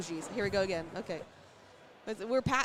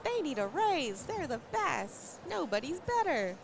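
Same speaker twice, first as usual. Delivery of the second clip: pitched up, slowed, over-the-top praise; playful fan cheering for the casters; mock gushing fan; 7 semitones higher than usual. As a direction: play a doting fan in chat, lightly hammed. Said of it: "You know, things like that".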